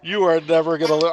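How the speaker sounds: normal talking voice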